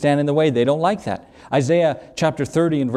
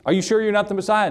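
quick, higher pressing question; doubtful questioner; 8 semitones higher, faster than usual. Retold or imitated. Crowd pressing John the Baptist again, quick and high. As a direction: press him fast and high, not believing him.

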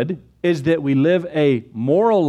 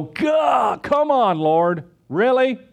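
high-pitched frustrated outburst, then a slow, drawn-out really; exasperated complaint; 7 semitones higher, much slower than usual. Retold or imitated. Believer griping at God over hard times, mock exasperated whine. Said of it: "you don't go".

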